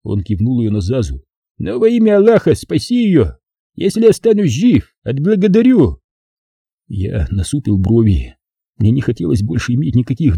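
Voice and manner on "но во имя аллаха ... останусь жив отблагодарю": high pleading voice of a desperate father figure; higher-pitched plea in the name of Allah; pleading man. Acted. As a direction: beg him, voice lifted high. Vakha begs to save sister.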